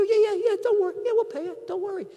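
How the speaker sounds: very high-pitched, put-on reassurance; playing along. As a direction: brush off the caller in a squeaky-high mock promise. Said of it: "I played along".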